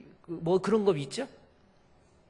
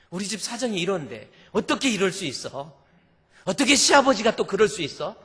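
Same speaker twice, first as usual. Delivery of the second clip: indignant questions building louder and higher; resentful outburst; 6 semitones higher, 9 dB louder than usual. Retold or imitated. Wife resenting her in-laws after the funeral, building indignant outburst. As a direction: act out mounting resentment, ending in a loud retort. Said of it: "막 이러면서 막 억울해가지고".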